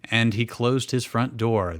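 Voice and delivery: normal talking voice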